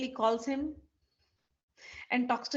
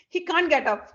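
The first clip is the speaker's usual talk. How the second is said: plain reading voice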